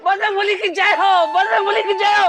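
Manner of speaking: high-pitched, excited hailing of the deity; startled devout cry. Acted. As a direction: cry out the god's praise in alarm. Waking in temple, hails the deity. (घबराकर ऊँची आवाज़ में जयकारा)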